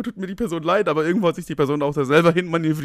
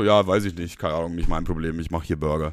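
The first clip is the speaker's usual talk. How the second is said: low, dragging, couldn't care less; bored fast-food worker; 9 semitones lower, 4 dB quieter than usual. Retold or imitated. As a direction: shrug it off like a bored employee. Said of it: "die haben das einfach so".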